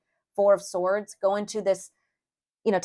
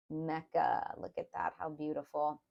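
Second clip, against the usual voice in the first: hushed awe; 4 semitones lower, 11 dB quieter than usual. Real, not acted. Awed by the card.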